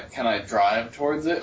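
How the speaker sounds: ordinary talk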